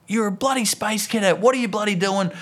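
high-pitched ranting scold; retold dad losing his temper; angry dad. Dad berates his kid on the walk back, angry dad rant. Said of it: "I've gone full angry dad mode".

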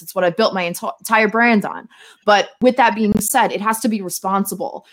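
ordinary talk, nothing marked

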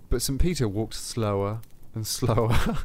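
ordinary delivery, nothing marked

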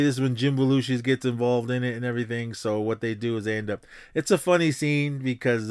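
ordinary talk

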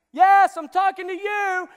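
high, slow shout at the audience; loud preaching. Real, not acted. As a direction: call them out at full volume. Preacher shouting directly at crowd.

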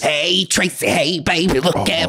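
high, gravelly-styled Edna Turnblad impression; sing-song Edna calling her daughter; Harvey Fierstein voice. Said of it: "I can do the Harvey Firestein real well".

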